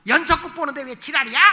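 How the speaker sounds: put-on mother annoyed at a child interrupting her soap opera, loud scolding; irritated yell. Shown as a demonstration. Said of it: "이러지 말고".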